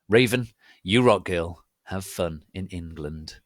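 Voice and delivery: ordinary delivery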